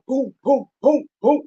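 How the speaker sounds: loud booming gunshot sounds; gunshot imitation. Retelling the shooting outside her apartment, loud mock gunshots. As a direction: punch out each boom like gunfire.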